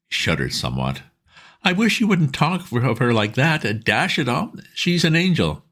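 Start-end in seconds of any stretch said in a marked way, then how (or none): 1.6-5.7 put-on much higher, earnest young chap; pleading pal, keen and urgent; eager Bingo. A narrator's reading. Bingo asking his old friend Bertie for help, eager and high.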